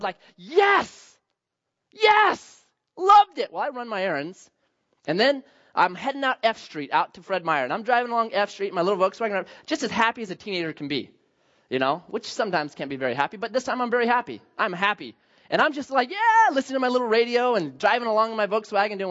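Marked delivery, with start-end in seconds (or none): 0.4-3.5 much higher, louder triumphant cheers; ecstatic yes. Retold or imitated. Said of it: "I felt so good". 16.1-16.5 much higher joyful yeah; happy whoop. Retold or imitated. Happy teen driving along.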